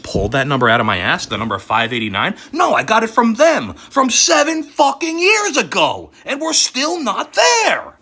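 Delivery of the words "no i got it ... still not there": high, loud outraged rant; furious yell. Real, not acted. Telling viewers the prediction failed, furious yell. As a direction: shout it out in fury. Ranting about missed target.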